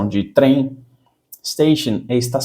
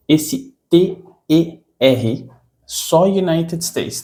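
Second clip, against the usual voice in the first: slow, careful explanation; 4 semitones higher, much slower than usual. Shown as a demonstration. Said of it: "teacher contrasting US and British spelling".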